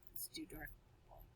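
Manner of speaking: quick whispered note; whispered aside. Real, not acted. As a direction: whisper to yourself. Muttering aside while working.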